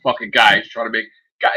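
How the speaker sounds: ordinary talk, nothing marked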